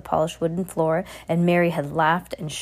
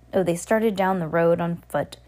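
plain reading voice

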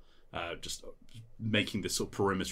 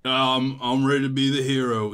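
loud, higher wobbly hero voice; dazed eager hero; 6 semitones higher, 9 dB louder than usual. Acted. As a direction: declare it loudly, half out of it. Dazed hero echoes that he's ready.

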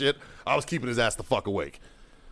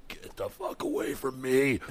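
slow, softer strained mock rasp; screamed-out voice; 5 dB quieter, slower than usual. Marine driving the kid off after screaming, strained wrecked voice. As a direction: imitate yelling on wrecked vocal cords. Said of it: "My vocal cords were like".

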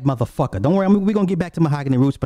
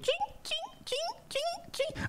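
much higher, thin screeching violin stabs from the shower scene; Psycho strings imitation; 16 dB quieter, slower than usual. Recalling a scary breakup, he squeals the Psycho strings. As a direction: mimic the Psycho knife strings in a high squeal. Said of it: "I hear the Psycho music".

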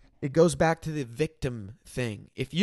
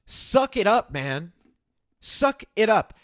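higher, louder, emphatic push; acted tough love; 5 semitones higher, 4 dB louder, slower than usual. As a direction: deliver a firm retold telling-off. Acted tough-love talk to housemate.

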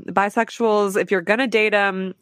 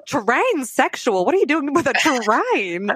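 put-on Southern accent; 3 semitones higher than usual. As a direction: play a scandalized Southerner. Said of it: "Southern accents".

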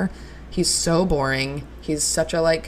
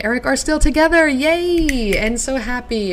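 excited cheer; 8 semitones higher, 5 dB louder than usual. Real, not acted. Cheering a couple still together.

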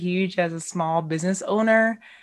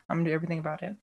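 quiet; 4 semitones lower, 8 dB quieter, much faster than usual. Acted. Repeating a vow.

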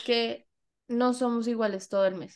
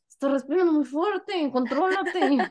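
annoyed mimic; 5 semitones higher, 4 dB louder than usual.

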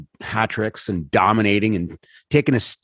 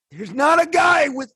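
exasperated shout. Real, not acted. Ranting about the Capitals' defence.

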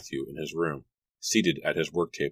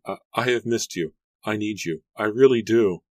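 higher, heartfelt outpouring; emotional pleading; 5 semitones higher, 5 dB louder than usual. A narrator's reading. Mary throws herself into Jeremy's arms, emotional pleading. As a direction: plead with rising pitch, louder at the end.

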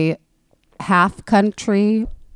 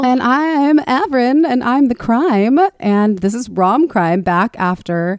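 show intro; 6 semitones higher, slower than usual. Acted. Podcast intro.

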